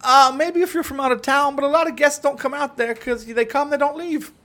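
a hammed-up Canadian from up north; mock Canadian accent. Telling Justin where he lives, in a mock Canadian accent.

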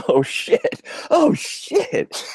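high laughing oh shit; laughing mock astonishment; mock shock. Real, not acted. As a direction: act blown away. Reacting to twist.

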